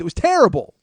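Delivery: high, very loud comic outburst; exasperated shout. Retold or imitated. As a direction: yell it as the rant's climax. Peak of the acted rant.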